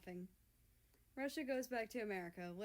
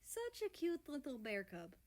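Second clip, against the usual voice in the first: purring; 6 semitones higher than usual. A narrator's reading. Russia to America, tender purring coo. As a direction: coo at your sweetheart. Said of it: "Russia purrs".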